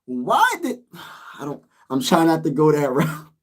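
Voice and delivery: normal talking voice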